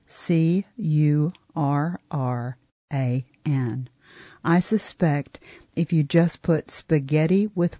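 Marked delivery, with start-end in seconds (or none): 0.1-3.9 C U R R A N spelled letter by letter, very slowly; spelling out letters. Shown as a demonstration. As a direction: spell out C U R R A N one letter at a time. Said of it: "let me spell some things for you".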